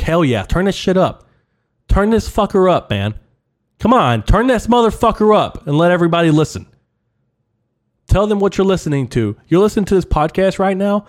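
hyped-up rant. Acted. Comic egging on listeners at work, hyped and loud. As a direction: hype them up to blast it.

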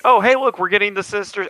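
bright, high, eager exclaim; hammed-up delight; mock excitement. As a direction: sound thrilled to spot them. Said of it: "I was like".

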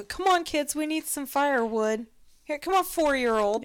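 put-on high, bright call to children; calling kids. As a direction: call the little ones over. Calling kids to gather firewood.

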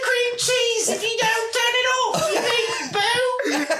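put-on very high, slow, posh British accent, Mary Poppins style; British lady impression. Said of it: "there's a British lady that drove by and was just like".

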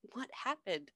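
mock secretive whisper. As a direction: ask it under your breath. [name] whispering back.

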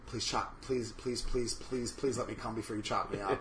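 soft, low, repeated begging; mock pleading. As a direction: beg under your breath, playfully. Acted plea to an imagined killer.

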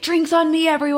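imitating Jordan. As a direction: mimic a friend buying the round. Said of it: "That was Jordan's line".

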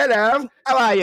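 high, loud jolly Brit; hammed-up British accent; mock British accent. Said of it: "as jolly and British".

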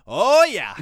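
big, loud, high imitation; hollered affirmation. Happy old worker confirming sauce is in box, hollering. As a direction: boom it out like an overjoyed worker. Acting out the enthusiastic sauce guy.

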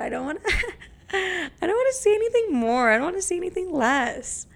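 giggling coyly. Real, not acted. Coy about dating life.

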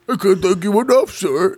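high lightly voiced monster. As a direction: squeak it out as the monster. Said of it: "you reply".

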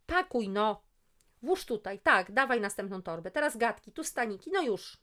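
loud, high rapid-fire orders; bossy directing. Acted. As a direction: conduct her like a drill sergeant. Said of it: "Dyrygowała, że hej".

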